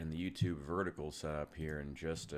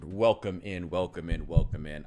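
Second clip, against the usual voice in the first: warm welcoming host; 8 dB louder than usual. Acted. Host greeting the stream.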